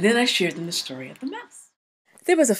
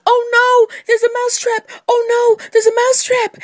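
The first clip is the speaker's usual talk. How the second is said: very high, loud panicked mouse; yelled warning; 9 dB louder than usual. Acted. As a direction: yell the alarm in a squeaky mouse voice. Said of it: "and he yelled".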